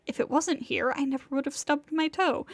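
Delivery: usual speaking voice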